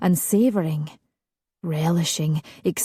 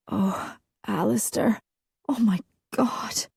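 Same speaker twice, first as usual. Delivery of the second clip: feverish murmur; 4 semitones higher, 4 dB quieter than usual. Acted. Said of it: "murmured feverishly".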